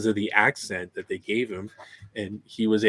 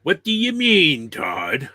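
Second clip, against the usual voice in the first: mock character voice; 6 semitones higher, 6 dB louder, slower than usual. Mocking a film line.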